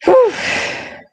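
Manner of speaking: panting after a workout; breathless, catching air; out of breath. Real, not acted. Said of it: "little out of breath".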